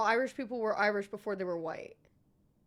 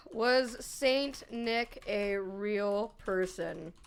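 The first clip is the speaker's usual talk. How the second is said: slow; 5 semitones lower, much slower than usual. Real, not acted. Slow wondering question.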